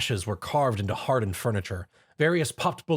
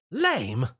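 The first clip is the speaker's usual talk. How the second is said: indignant; 3 semitones higher than usual. A narrator's reading. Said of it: "Rarity interjected indignantly".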